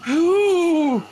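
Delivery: high, very loud who; hammed-up wail. A narrator's reading. Chris demanding the culprit's name, high loud wail. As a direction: wail it out dramatically.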